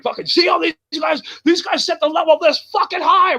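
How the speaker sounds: high-pitched excited raving; ecstatic radio guy. Retold or imitated. Imitating the radio guy telling other bands, ecstatic high voice.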